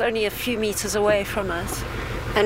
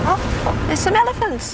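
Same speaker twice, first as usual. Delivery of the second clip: high-pitched surprised exclamation; real surprise, much higher; surprised discovery; 10 semitones higher, 3 dB louder than usual. Real, not acted. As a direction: pitch leaps up on spotting something. Spots elephants at night.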